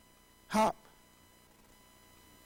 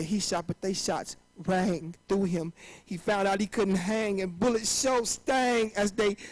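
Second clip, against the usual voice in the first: normal talking voice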